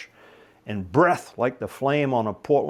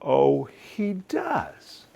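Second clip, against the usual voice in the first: sarcastic drawl; 6 semitones higher, much slower than usual. Acted. Charlie sarcastic to serpent.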